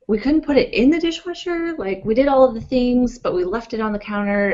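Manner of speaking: high, loud, exasperated outburst; snapping in anger. Retold or imitated. Wife snapping at husband over a cereal bowl, high and loud. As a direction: let it snap out, sharp and high. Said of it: "I just snapped".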